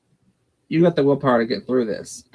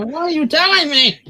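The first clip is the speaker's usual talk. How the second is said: mock disbelief; 13 semitones higher, 5 dB louder than usual.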